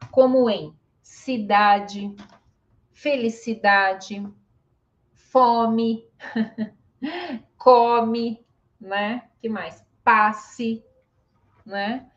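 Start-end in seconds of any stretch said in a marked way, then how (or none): 1.0-4.2 "cidade, felicidade" ending in -jee; final E as I. Shown as a demonstration. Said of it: "quando que ele vai ter som de I". 5.1-8.5 "fome", "come" ending in mee; final E as I. Shown as a demonstration. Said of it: "final de palavra". 10.0-10.8 "Passe" as PAH-see; final E as I. Shown as a demonstration. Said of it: "final de palavra".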